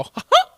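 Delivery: high loud chuckle with a skip; squeaky falsetto cartoon laugh; Mickey Mouse laugh. Shown as a demonstration. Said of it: "a little skip".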